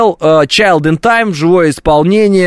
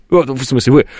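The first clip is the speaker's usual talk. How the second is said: normal talking voice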